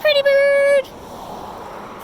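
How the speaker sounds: slow, high sing-song coo; parrot voice. Real, not acted. Baby talk to parrot.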